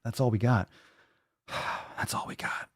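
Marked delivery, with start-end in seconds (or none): 1.5-2.7 breathy sigh into a whispered line; sigh, then whisper it. Shown as a demonstration. Repeating the sign-off line.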